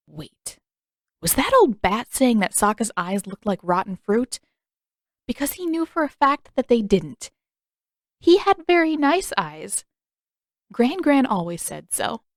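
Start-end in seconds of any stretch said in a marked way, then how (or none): 5.3-12.2 high, wounded pride; indignant vanity. A narrator's reading. Sokka insists on his nice eyes, high and huffy. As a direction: sound offended on his behalf.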